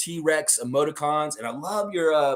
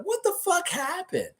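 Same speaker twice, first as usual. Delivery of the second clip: much higher, incredulous; mock outraged disbelief. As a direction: squeak out the disbelief. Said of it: "I'm gonna be like".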